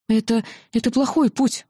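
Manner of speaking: mildly indignant outburst; blurted out. A narrator's reading. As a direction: blurt it after a false start. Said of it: "выпалила наконец".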